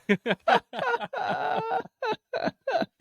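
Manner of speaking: long, high-pitched laughing fit; real high cackling laugh; helpless laughter. Real, not acted. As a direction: crack up laughing at yourself. Cracks up after retold request.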